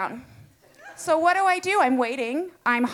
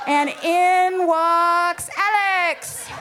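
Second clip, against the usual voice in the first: dramatic reveal; 4 semitones higher, much slower than usual. Acted. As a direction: announce his entrance with mock drama. Dramatic entrance of Alex.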